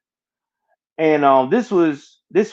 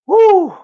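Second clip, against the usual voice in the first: a sharp high whoo, letting the pain out; heavy whoo exhale; 6 dB louder than usual. Real, not acted. Recalling his brother's death, a loud high whoo. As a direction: blow out a loud high whoo to steady yourself.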